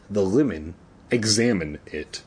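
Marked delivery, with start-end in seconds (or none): none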